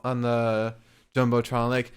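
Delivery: normal talking voice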